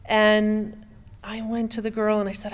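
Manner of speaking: ordinary talk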